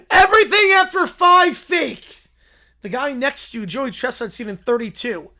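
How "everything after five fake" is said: higher, louder, slow dim bear shout. Acted. Little Brown Bear shouting about romance, slow and loud. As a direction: bellow it like a lovesick bear.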